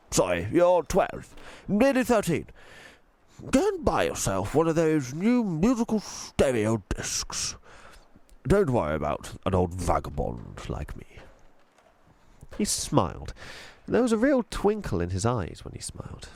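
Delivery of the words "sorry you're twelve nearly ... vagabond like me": posh tramp, gently refusing; posh old tramp. A narrator's reading. Tramp refusing the girl's money, posh and kind. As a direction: turn down the child's gift kindly, posh.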